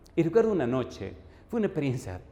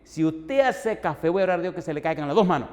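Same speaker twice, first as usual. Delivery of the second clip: mock threat; 4 semitones higher, 6 dB louder than usual.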